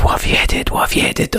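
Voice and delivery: whispered imitation of curious listeners; mock listeners asking. Host imitates listeners quizzing the edit, whispered mock query. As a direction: breathe it like a nosy fan. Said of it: "everyone's gonna go".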